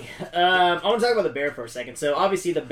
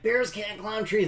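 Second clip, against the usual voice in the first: put-on higher, lightly drawled Southern kid voice; a hint of an overdone Southern movie accent; mock Southern accent; 7 semitones higher than usual. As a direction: poke fun at a thick Southern accent. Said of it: "thickest Southern accent".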